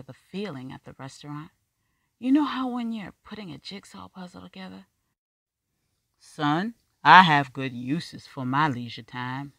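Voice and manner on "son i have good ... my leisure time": put-on loud, dismissive older man's voice; gruff older man. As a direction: brush him off like a weary elder.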